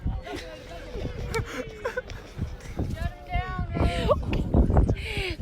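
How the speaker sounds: laughing. Real, not acted. Teasing about drinking.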